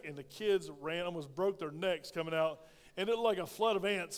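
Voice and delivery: ordinary delivery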